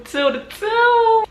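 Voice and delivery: mocking singsong. Mocking the ex in a high, slow silly voice. Sarcastic put-on voice.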